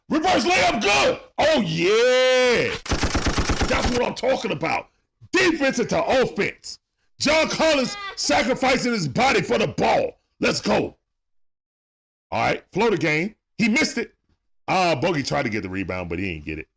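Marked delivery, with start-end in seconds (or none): 0.1-11.0 excited play-by-play. Real, not acted. Streamer calling the Hawks game live for his chat, excited play-by-play. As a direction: call the action like a hyped fan commentator.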